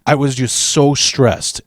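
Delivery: ordinary talk, nothing marked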